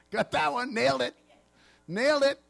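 mock boast of a pleased disciple; Peter. Peter congratulating himself on his good answer, smugly pleased.

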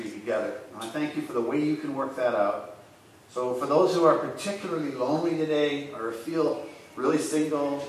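soft, earnest prayer voice. Real, not acted. Pastor praying softly for the lonely. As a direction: pray quietly to God for the lonely.

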